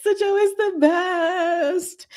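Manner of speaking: slow, high, through laughter; giggling declaration, drawn out; laughing gush. Real, not acted. Laughing, gushing about favourite couple.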